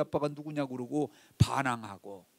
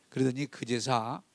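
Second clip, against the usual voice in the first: usual speaking voice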